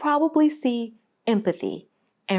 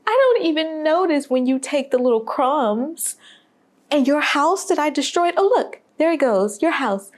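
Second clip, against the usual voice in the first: higher voice of the man talking down to the mouse; the man speaking; 5 semitones higher than usual. Retold or imitated. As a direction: play the man, pitched up, addressing the mouse. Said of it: "continues to say".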